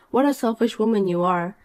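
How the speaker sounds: ordinary talk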